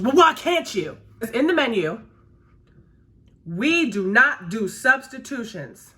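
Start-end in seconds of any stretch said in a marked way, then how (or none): 0.0-1.0 sharp, higher and louder demand; pushy customer. Retold or imitated. Customer snapping at the server, loud and demanding. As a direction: snap back at the server like an entitled customer. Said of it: "She goes".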